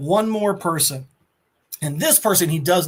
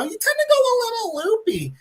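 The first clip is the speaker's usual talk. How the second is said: loopy high voice. Retold or imitated. Ghost Rider calls himself loopy.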